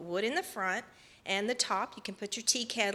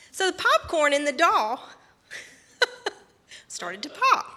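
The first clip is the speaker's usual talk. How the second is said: loud, higher, breaking into a laugh; 7 semitones higher, 7 dB louder than usual. Real, not acted. Storyteller reaching the funny popcorn twist, laughing. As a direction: laugh through the punchline.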